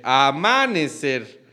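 amanecer with a long, stressed MA; a-MAAA-necer. Shown as a demonstration. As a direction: hit the second syllable of amanecer. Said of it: "¿Cuál es la sílaba tónica".

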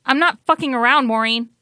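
loud, high, hurried warning; angry insistence. Acted. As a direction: cut in forcefully to prove you mean it. Val insists she is serious.

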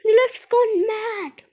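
slow, high, loud wail of alarm; alarmed cry. A narrator's reading. Panicked passenger in runaway lift.

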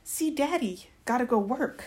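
put-on high, loud cheeky little-kid voice; smirking toddler. A narrator's reading. Little girl heading off to dad, high cheeky toddler voice. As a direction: pipe it up like a cheeky tot. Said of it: "smirks, and says".

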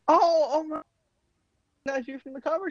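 high-pitched, halting surprise in a retold scene; astonished recognition. Guest recognizes the singer from the cover, astonished. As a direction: let the realization lift the voice high.

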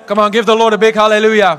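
very loud, fast rallying call; rousing shout. Real, not acted. Preacher calling for a big hallelujah, shouted. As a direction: shout it out to the whole church. Calls congregation to shout praise.

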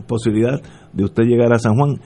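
ordinary delivery

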